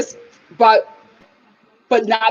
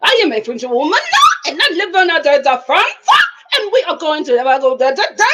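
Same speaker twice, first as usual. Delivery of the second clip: put-on halting French accent jumping up at phrase ends; hammed-up, stammering French accent; mock French accent; 4 semitones higher, 4 dB louder, faster than usual. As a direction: lurch through an exaggerated French accent. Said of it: "It just starts here, and then it goes up".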